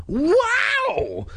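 very high, wide-open wow; soaring, astonished cry; amazed exclamation. Acted. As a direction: squeal it out in acted awe. Cousin amazed at the mummies.

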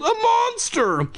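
very high, drawn-out cry of alarm; terrified wail. Acted. Kid fleeing the monster baby, high theatrical wail. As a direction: wail it in hammed-up horror. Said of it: "I wailed".

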